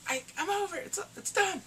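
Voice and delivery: high, strained quoting of Bayu giving up; mock high voice. Man telling the other he quits, high strained voice. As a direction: squeak it out like a man who's done. Retelling movie character giving up.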